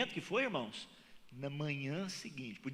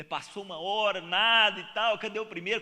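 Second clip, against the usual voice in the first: louder, higher storytelling on the wait; building impatience; 4 semitones higher, 10 dB louder than usual. A narrator's reading. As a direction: pump up the waiting father's suspense. Father waiting, calling out. (narrando a espera do pai, cada vez mais alto)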